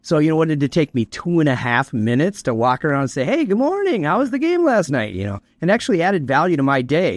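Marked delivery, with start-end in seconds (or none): 3.2-5.1 high-pitched, sociable office hello; cheery greeting. Acted. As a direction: greet coworkers in a sing-song high voice. Acts out chatty morning greeting to staff.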